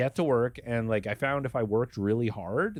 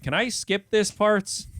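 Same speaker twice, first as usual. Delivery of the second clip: whiny mock plea; 10 semitones higher, 5 dB louder, slower than usual. His reply in training.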